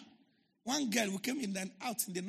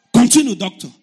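loud, firm order to go on; defiant loud command; 2 semitones higher, 21 dB louder than usual. Retold or imitated. Pastor defiantly tells the doctor to continue, loud firm order. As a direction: bark it out, loud and sure.

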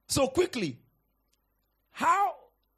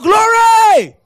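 high, very loud cry of Glory!; shouted glory; 13 semitones higher, 21 dB louder than usual. Shown as a demonstration. Preacher showing the church how to shout, Glory! loud and high. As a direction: throw Glory! out as a joyful shout. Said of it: "So when we shout".

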